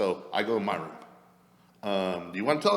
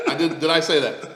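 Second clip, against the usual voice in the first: ordinary talk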